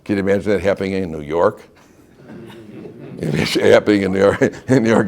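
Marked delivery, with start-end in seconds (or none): 2.3-5.1 talking through a laugh. Real, not acted. Teller imagines the gun story in New York, laughing. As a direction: let amusement break up the words.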